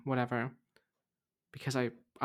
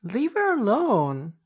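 high, loud, slow protest; 8 semitones higher, 7 dB louder, slower than usual. Real, not acted. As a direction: tell them off loudly.

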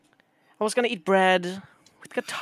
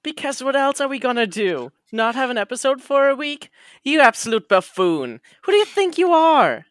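mock outrage; 6 semitones higher, 7 dB louder than usual.

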